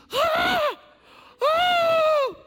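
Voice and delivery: very high shrieking gasp of shock; startled shriek. Acted. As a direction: shriek like a girl seeing a ghost. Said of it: "she starts yelling".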